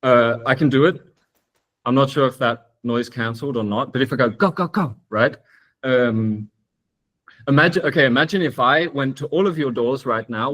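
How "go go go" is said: three higher vocal knocks; imitated door knock. Shown as a demonstration. Speaker shows listeners a knock on a door, imitated knocking. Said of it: "knocking a door, that sound".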